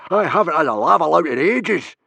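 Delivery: high, loud, greedy plea; eager yell. A narrator's reading. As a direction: yell it keenly to the chief. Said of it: "yelled Baggy Bum".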